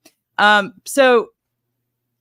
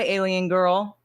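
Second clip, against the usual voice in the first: usual speaking voice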